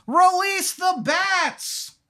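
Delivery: high, slow, drawn-out mock cry; yelled command. Tour guide yells to release the bats, high and drawn out. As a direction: stretch it like a villain's battle call. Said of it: "you hear him just yell".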